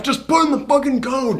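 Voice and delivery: high-pitched, exasperated yell; acted frantic demand. Villain orders protagonist to enter code, frantic. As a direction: urge it out in mock panic.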